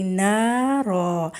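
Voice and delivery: نا را slow with both alifs drawn out equally; pausal long a. Shown as a demonstration. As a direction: stop on نا را, stretching both vowels evenly. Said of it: "اب ہم اس کو لمبا کریں گے". (نا را وقف کے ساتھ دونوں برابر لمبا)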